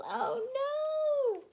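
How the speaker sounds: high squeaky oh no; high cartoon gasp of dismay; comic dismay. A narrator's reading. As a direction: cry out in mock alarm. Narrator exclaims at crash.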